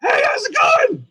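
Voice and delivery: very high, cheery creature voice; squeaky puppet Boogeyman. Acted. Boogeyman greeting a new guest, very high puppet voice. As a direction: pipe up in a high puppet falsetto.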